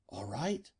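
hushed, anxious question. A narrator's reading. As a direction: ask very softly, worried. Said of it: "she asked anxiously".

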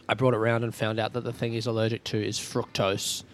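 ordinary talk, nothing marked

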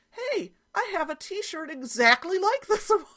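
high, loud imitation breaking into laughter; loud, high-pitched mock excitement; clueless excited daughter. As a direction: mimic a daughter's innocent discovery, cracking up. Clueless daughter quoted, teller laughing.

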